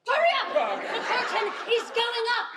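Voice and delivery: loud urgent push; hurrying shout. Acted. Stage manager rushing actor before curtain, loud urgent shout. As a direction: shout him onto the stage.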